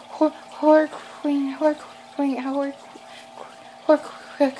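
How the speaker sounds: "Horror Queen" high, mangled and stuck on repeat; nervous accent stumble. Retold or imitated. Fan nervously tells Ricky "Horror Queen", high garbled stumbling. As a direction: keep tripping over "Horror", high and faltering. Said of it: "me being nervous".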